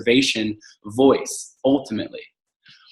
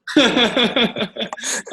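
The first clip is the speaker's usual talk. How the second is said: high-pitched, rapid run of laughter; 12 semitones higher than usual. Real, not acted. Amused after host's interjection.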